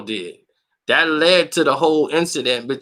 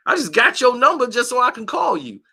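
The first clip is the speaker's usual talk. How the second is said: sarcastic comeback; 9 semitones higher than usual. Retold or imitated. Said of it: "I'm like".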